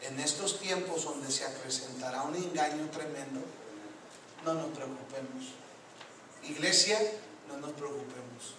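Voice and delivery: soft, low preaching with long pauses; calm closing exhortation. Real, not acted. Preacher closing his sermon to the church, hushed reassurance.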